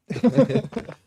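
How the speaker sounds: loud laugh with a rising end. Real, not acted. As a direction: laugh at the awkward moment. Laughs at awkward photo.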